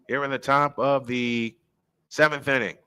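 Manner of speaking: normal talking voice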